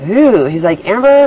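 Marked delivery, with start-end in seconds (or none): 0.1-0.4 higher, louder shocked ooh; boss's alarm. Retold or imitated. Boss alarmed at her look. 0.9-1.3 high, loud startled call; boss's alarm. Retold or imitated. Boss alarmed at her look.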